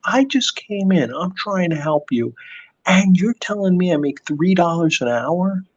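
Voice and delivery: a hint of a hurt worker standing up; Bob's reply. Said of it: "He's like".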